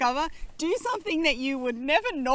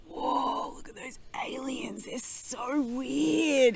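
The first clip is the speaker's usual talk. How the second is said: hushed amazed whisper; awed whisper; much slower than usual. Retold or imitated. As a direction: breathe it out in awe. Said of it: "we would be like".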